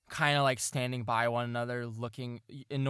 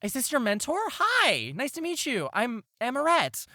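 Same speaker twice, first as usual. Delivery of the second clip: mom's voice much higher, a little louder; mother meets the mentor, high and gushing; bright high greeting; 12 semitones higher, 5 dB louder than usual. Acted. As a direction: gush a friendly hello to the mentor.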